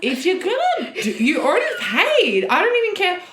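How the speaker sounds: loud, high-pitched exasperation; real indignation. Real, not acted. Telling co-host about a cheeky date, indignant and loud. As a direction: protest in disbelief, voice shooting up. Outraged at date requesting money afterwards.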